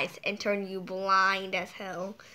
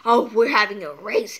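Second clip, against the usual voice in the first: excited idea; 10 dB louder than usual. Real, not acted. Imagining planets racing.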